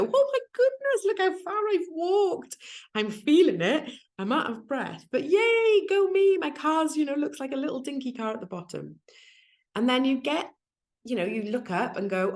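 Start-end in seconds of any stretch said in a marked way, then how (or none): 0.0-9.0 excited. Retold or imitated. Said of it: "you look back and go".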